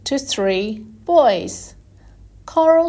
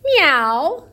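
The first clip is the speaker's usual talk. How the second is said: cat meow; 5 semitones higher, 4 dB louder than usual. Shown as a demonstration. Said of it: "said the little chick".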